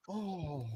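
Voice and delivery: very soft imitated crowd "oh"; drawn-out hushed "ohh" of a moved audience. Audience reacting to the hug, imitated soft drawn-out "oh". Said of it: "everyone in the audience goes".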